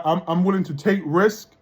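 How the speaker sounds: ordinary delivery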